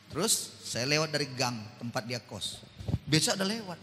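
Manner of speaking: ordinary talk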